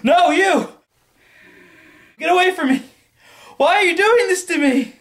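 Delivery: high, loud panicked cries; terrified shrieking. Acted. Victim screaming in panic at the menacing double. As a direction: scream at the attacker in terror. Victim begs attacker in skit.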